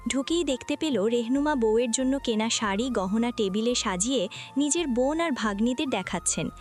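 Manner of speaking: plain reading voice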